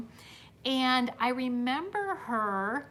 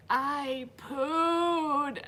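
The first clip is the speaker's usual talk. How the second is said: higher, drawn-out imitation of a kid; mock wailing child; 4 semitones higher than usual. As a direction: mimic a crying boy without shouting. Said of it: "he's still screaming, crying, and he's like".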